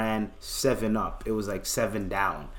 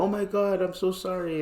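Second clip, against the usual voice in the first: high-pitched put-on pleading; mock apology; 7 semitones higher than usual. Smoker acting sorry to police, high mock pleading. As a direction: plead sorry in a raised voice, softening at the end.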